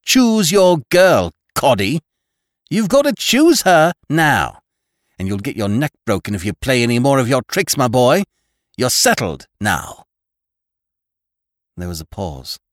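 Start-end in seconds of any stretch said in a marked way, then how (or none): 0.0-4.6 loud, high, slow command; fierce demand. A narrator's reading. Girl ordering Coddy to choose, fierce and loud. 5.2-10.1 loud, higher-pitched threat; threatening. A narrator's reading. Girl warning the cornered man off tricks, threatening.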